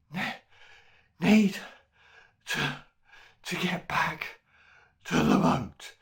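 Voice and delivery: broken, out-of-breath words; panting. A narrator's reading. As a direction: gasp it out while running. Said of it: "panted Rhys William".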